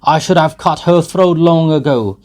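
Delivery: put-on drunken boastful shouting. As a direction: brag and bellow at the lads. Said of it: "he shouted from the cart".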